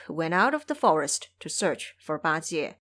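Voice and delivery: ordinary talk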